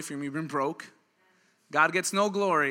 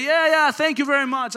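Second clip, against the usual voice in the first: loud, high, impatient thanks; brusque brush-off; 7 semitones higher, 8 dB louder than usual. Acted. Frustrated young driver to old man, brusque brush-off. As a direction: brush him off with a raised voice.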